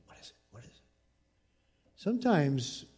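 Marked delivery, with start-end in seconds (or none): 0.1-0.8 breathless whisper of curiosity. Retold or imitated. Curious kids whispering to the leader. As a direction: lean in and whisper.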